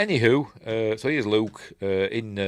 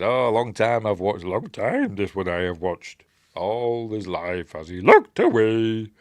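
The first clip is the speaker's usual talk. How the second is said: slow, drawn-out creature imitation; mock Yoda; 4 dB louder, slower than usual. Yoda lamenting Luke's wandering mind, slow imitation voice. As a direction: stretch the lament out, louder at the end. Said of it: "he says".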